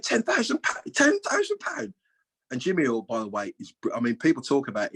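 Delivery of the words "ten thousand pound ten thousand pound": "Ten thousand pound" squeaked much higher and slower; mock disbelief. Chairman repeats the owed sum, in mock high-pitched disbelief.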